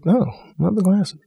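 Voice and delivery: actor's audition read, turning the line from negative to genuinely admiring; sincere positive read. Retold or imitated. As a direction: say love the glasses as a real compliment, lifted high and slow. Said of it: "So I said".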